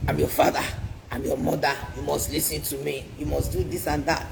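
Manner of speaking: imitating the parent who ends all questions; mock strict parent.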